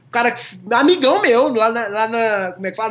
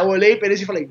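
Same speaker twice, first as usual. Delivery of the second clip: ordinary talk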